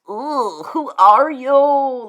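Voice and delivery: loud, high, slow disgusted jeer; disgusted ooh. Real, not acted. Streamer jeering at an annoying chatter, loud disgusted sneer.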